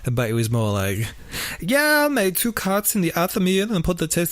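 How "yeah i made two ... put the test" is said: higher, mock German accent, offhand; tossing off the confession in a hammed-up German accent. Podcaster imitating the killer casually in a German accent. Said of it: "So you did the German accent anyway".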